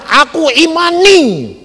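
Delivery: high, drawn-out mock proclamation; imitated declaration of faith. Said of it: "Tapi kalau kamu bilang". (menirukan pernyataan iman, tinggi dan panjang)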